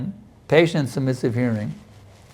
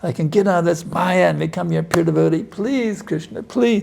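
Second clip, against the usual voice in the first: slow, desperate begging that climbs higher; slow begging that breaks high on the final please; pleading prayer; 4 semitones higher than usual. Pretend. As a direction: plead with Krishna as if drowning. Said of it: "You have to plead with Krishna".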